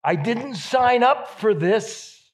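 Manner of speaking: high, loud and slow, a protest flung at God; exasperated outcry. Retold or imitated. Pastor voicing a woman who is angry at God, loud slow protest. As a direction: voice a woman shaking her fist at heaven. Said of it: "She wanted to say".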